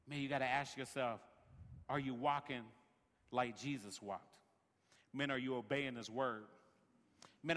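normal talking voice

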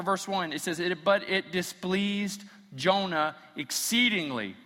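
high, loud fervent preaching; preaching at full force. Acted. Pastor building up to the climax of his sermon, preaching loud. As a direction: drive the point home to the congregation.

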